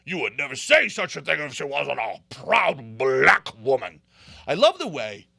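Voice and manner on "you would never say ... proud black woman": mocking offended voice, a little louder; hammed-up imitation of an indignant defender; mock indignation. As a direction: parody their outrage. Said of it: "they're like".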